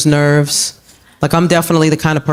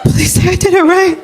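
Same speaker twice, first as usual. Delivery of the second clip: high pleading; 13 semitones higher than usual. Real, not acted.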